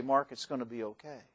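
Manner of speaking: comforting God, soft and gentle; calm reassurance. Acted. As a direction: hush a frightened friend. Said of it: "calm us and say".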